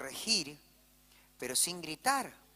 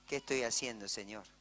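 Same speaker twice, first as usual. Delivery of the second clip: remorseful; 7 semitones lower, 3 dB quieter than usual. Retold or imitated. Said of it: "me empecé a arrepentir".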